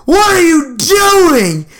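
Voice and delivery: high, drawn-out mock yell; imitating a scandalized younger sister; shocked kid's shout. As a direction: yell it slow and high, appalled. Shocked kid catches them.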